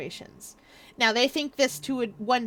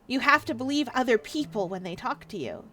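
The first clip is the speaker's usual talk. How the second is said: normal talking voice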